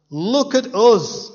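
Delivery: high, loud, slow proud boast; mocking Pharisee boast. Preacher mocking the Pharisees to the guards, in a high boastful voice. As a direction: puff up like a smug religious leader. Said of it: "they're saying".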